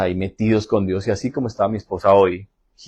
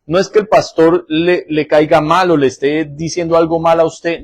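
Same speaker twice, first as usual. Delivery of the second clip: forceful preaching; 6 semitones higher, 5 dB louder than usual. Acted.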